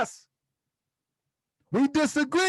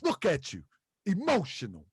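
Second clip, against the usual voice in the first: lower, softer mocking battle-rapper taunt; 6 semitones lower, 3 dB quieter than usual. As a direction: drop the voice low and taunt. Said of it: "And my [name] mo-, voice".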